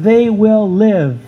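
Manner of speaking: slow, weighty They will live; emphasized. Shown as a demonstration. Preacher stressing the promise to the church, slow and weighty. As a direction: lean on They, every word slow. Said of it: "Let's emphasize what happens to them".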